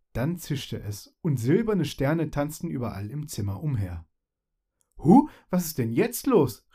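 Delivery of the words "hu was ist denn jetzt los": higher, louder startled cry. Acted. Primavera startled by sparkling stars, frightened cry. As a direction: jump at the sudden hiss. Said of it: "rief Primavera erschrocken".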